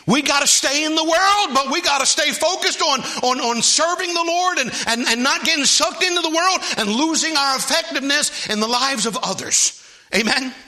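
high, loud preaching build; full-force preaching. Acted. Preacher exhorting the church to stay focused, at full force. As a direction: drive it home to the congregation.

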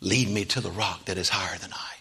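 a quiet cry to God; hushed, sincere prayer. Acted. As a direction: drop it down to a tender plea. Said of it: "his cry is".

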